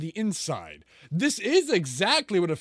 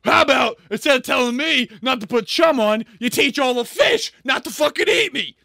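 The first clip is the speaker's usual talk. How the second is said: higher, louder mock outrage, hammed up; mock indignant shout; 4 semitones higher, 7 dB louder than usual. Mocking a swimmer who resents a shark warning, hammed-up indignant shout. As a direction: shout it like an offended activist, overdone. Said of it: "And you're like".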